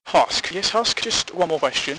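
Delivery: usual speaking voice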